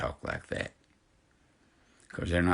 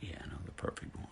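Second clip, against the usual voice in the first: whispered reply; 10 dB quieter, faster than usual. Retold or imitated. Retelling his reply to the girl.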